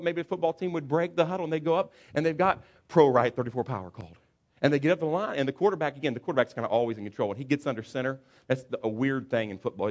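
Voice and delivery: usual speaking voice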